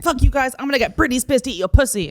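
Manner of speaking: hammed-up belligerent threat; aggressive and angry, retold. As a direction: imitate an angry man's tirade. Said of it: "aggressive and angry".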